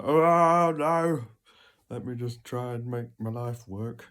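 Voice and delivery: high, loud mock panic; hammed-up fretful voice; mocking whiny voice. Mock quote of avoider.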